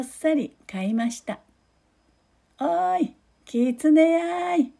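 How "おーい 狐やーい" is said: high, slow, drawn-out call. Acted. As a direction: call out across the hills. Old man calling the fox.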